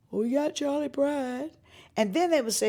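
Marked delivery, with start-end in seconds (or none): 0.1-1.5 pitched-up mocking parrot of the execs; singsong mock of a stock answer; mimicking executives. Singer parroting the record label's excuse, mocking pitched-up voice.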